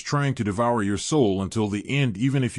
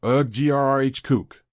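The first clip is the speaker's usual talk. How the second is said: pained growl; 2 semitones higher than usual. Acted. Possessed man groans and growls in agony. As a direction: groan sliding into a choking growl. Man fighting his inner demon.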